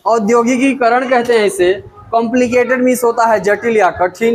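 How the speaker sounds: usual speaking voice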